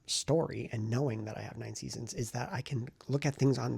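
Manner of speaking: normal talking voice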